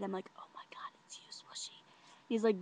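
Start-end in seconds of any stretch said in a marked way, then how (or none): 0.4-1.8 hushed, stunned whisper; awed whisper. Retold or imitated. Confirming it is the boy she nicknamed, awed whisper. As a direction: whisper it in disbelief.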